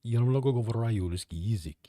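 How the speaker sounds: switched foreign-language voice; Polish speaking voice. Shown as a demonstration. Streamer showing chat her other-language voice. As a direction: let the voice shift into Polish. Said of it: "doesn't feel as right as saying".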